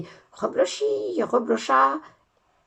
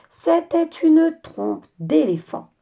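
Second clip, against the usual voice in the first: high, loud and slow reveal; dramatic reveal; 8 semitones higher, 8 dB louder, slower than usual. A narrator's reading. Narrator revealing Lola's nose is a trunk. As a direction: announce it like a big surprise.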